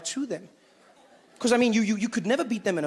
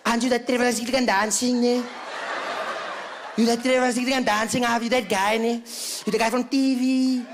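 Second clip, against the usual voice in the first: drawn-out, toothless character voice with raised pitch at times; toothless burly woman; 3 semitones higher than usual. Retold or imitated. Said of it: "talking to a person that had no front teeth".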